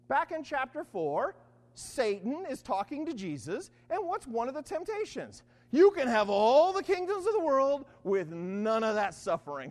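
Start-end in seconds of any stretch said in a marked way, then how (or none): 5.7-9.7 loud, grand mock sales pitch; retold tempter's offer. Satan tempting Jesus with the kingdoms, loud and grand.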